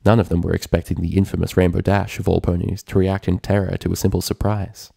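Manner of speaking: usual speaking voice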